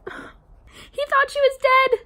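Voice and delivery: high, drawn-out wail of heartbreak; sigh, then squeak it out slowly in anguish; anguished reading. Acted. Dramatic line read from book.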